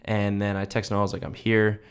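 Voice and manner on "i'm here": normal talking voice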